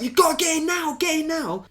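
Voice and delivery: high, frantic pleading into the phone; retold panic on the phone to police; urgent plea. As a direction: beg them to hurry. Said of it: "I was like".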